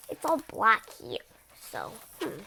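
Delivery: plain reading voice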